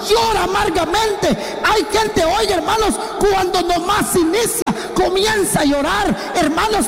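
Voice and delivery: high, ringing pulpit voice; fiery preaching. Acted. Preacher warning the church against drifting, fiery. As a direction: preach it hard to the congregation.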